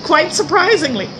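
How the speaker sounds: bursting into a high laugh. Real, not acted. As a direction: laugh it out, pleasantly surprised. Amused at her own success.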